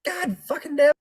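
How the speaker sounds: high-pitched, exasperated wail; exasperated complaint. Real, not acted. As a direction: complain at the others in squealing dismay. Host exasperated, nobody told him.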